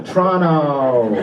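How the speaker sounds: comic showing the crowd a soulful Toronto, slow and heartfelt. Shown as a demonstration. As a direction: say Toronto slowly and deeply, like you mean it. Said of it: "make it come from your soul".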